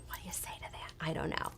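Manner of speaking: soft unvoiced aside whisper. Real, not acted. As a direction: drop to a whisper, as if sharing a secret. Aside after client's funny question.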